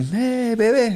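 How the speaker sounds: high, sing-song imitation of her calling; mock flirty call. Sex worker calling out, mimicked.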